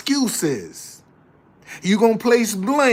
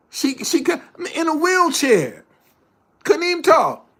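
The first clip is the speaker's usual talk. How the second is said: preaching emphasis; 6 semitones higher than usual. Real, not acted. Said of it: "rising preaching emphasis".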